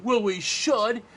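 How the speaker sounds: high, loud, hammed-up insistence; eager mock comeback. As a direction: fire back with acted enthusiasm. Bill's retold eager comeback.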